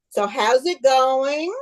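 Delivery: slow, stretched-out casual question; drawn-out inquiry. Acted. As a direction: draw out the last word as you drop in to check. Rabbi casually checks on the chaos.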